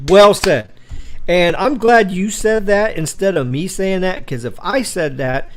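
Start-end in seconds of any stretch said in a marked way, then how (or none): 0.1-0.7 loud approval. Real, not acted. Praising a viewer's chat comment.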